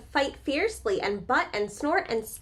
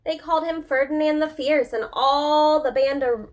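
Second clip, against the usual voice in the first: louder, building read-aloud drama; dramatic story reading; 5 dB louder than usual. A narrator's reading. Teacher reading the bullfight to children, louder and grander. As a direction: announce each bullfighter with growing excitement.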